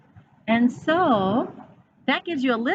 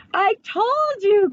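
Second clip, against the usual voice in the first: told-you cry; 9 semitones higher, slower than usual. A narrator's reading. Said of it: "cried Eddie".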